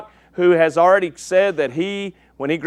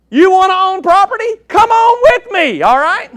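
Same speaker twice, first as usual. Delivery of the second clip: mock salesman pitch; 11 semitones higher, 6 dB louder than usual. Joking sales pitch to audience.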